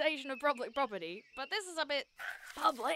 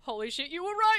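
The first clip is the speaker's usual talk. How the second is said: louder thrilled exclamation; excited; 5 semitones higher, 6 dB louder than usual. Real, not acted. Player realises her friend was right, excited shout. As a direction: shout the discovery. Discovery of the clue.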